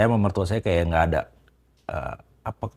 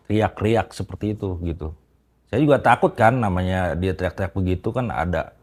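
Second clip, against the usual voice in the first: ordinary talk, nothing marked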